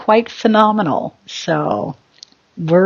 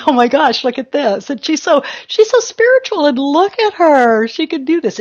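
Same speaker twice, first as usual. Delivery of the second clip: mock viewer voice; 7 semitones higher than usual.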